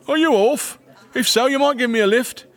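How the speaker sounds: high, forward request; hearty Farmerson. A narrator's reading. Farmerson asks the departing couple for a lift, high and hearty. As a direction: call out cheekily for a favour.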